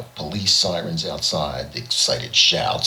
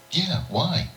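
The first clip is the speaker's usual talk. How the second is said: much higher, alien snake voice; snake creature voice. Acted. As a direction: squeak it out like a small creature. Snake creature answers George.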